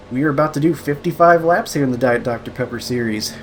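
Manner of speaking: ordinary talk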